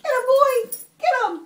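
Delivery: high, loud play command; excited urging. Real, not acted. Mommy urging River onto the squirrel, excited urging. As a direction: cheer the animal on.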